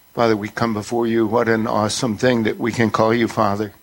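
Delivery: ordinary talk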